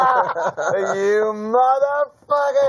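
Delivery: high, long-stretched swear through laughter; mock-outraged curse. Gambler cursing the dealer's card, drawn-out mock outrage.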